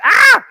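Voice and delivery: high loud fan scream; excited scream. Retold or imitated. Fan remembering [name] passing by, screaming loud. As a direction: let out a high delighted scream.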